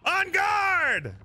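theatrical en garde, pitched high; mock duel call. Player challenging his friend to a sword duel, theatrical call.

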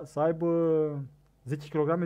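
usual speaking voice

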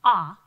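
Ah said loud as an isolated short vowel; short o sound. Shown as a demonstration. Teacher isolating the short o sound. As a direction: punch the vowel Ah on its own. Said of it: "is a short vowel sound".

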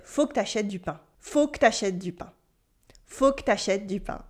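"Faut que t'achètes du pain" run together fast, il and vowels dropped; clipped everyday "Faut que t'achètes du pain"; relaxed native "Faut que t'achètes du pain"; casual spoken French. Shown as a demonstration. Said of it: "We would say instead".

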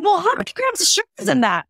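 high, fast, mock-innocent question; acting dumb. Mom quizzing her daughter about the soda, feigning innocence. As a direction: ask as if you truly don't know. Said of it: "And I'm kinda acting dumb".

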